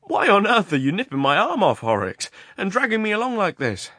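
loud, high indignant outburst; nervous, snarling protest. A narrator's reading. Asking why his arm is being nipped, nervous and snarling. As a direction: object uneasily at full pitch. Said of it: "with an undertone of snarl in his voice".

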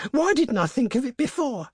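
very high, frantic self-reproach, "Why didn't I"; hysterical. A narrator's reading. Wife blaming herself for the late idea, hysterically. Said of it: "she said hysterically".

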